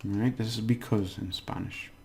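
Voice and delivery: usual speaking voice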